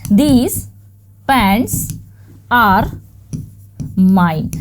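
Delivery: "These... pants... are... mine", a clear pause after each word; word-by-word demonstration. Shown as a demonstration. Teacher building the example sentence for learners, word by word. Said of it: "क्या आपका sentence हो जाएगा".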